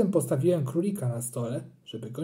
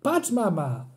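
call out; 4 semitones higher, slower than usual. A narrator's reading. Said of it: "zawołałem".

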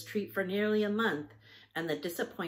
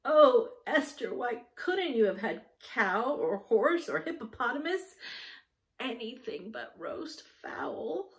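higher, half-choked mock lament; half-choked wail; 6 semitones higher, 5 dB louder than usual. Judy begging Esther about the roast fowl, half-choked wail. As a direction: wail it like a heartbroken child. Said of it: "said Judy in a half-choked voice".

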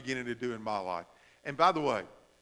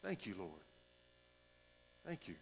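breathed out very softly, almost inaudible; a quiet, grateful prayer under the breath; soft thanks; 9 semitones lower, 23 dB quieter than usual. Shown as a demonstration. Pastor models a whispered prayer of thanks to God. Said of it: "that's a wonderful phrase right there".

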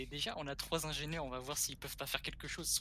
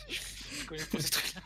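laughing; 6 semitones higher, 16 dB louder than usual. Real, not acted. Laughs while protesting.

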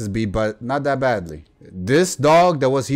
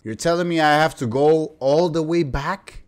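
ordinary talk

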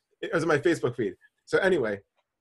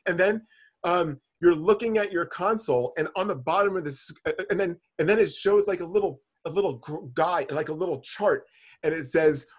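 ordinary delivery

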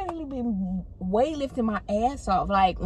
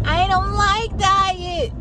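high, slow, whiny mock voice; high drawn-out snooty whine; mocking diet hater; 11 semitones higher, 4 dB louder, much slower than usual. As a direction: imitate someone turning their nose up. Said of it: "one of them people that's like".

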